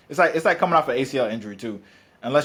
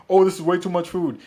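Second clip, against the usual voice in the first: mock complaining client, louder and higher; whiny client; 6 semitones higher, 5 dB louder than usual. Coach imitating a client protesting the portion size, whiny. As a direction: whine it like a client handed too much food. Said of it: "they always say the same thing, like".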